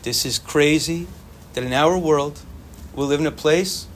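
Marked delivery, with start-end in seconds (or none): none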